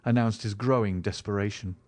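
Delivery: ordinary delivery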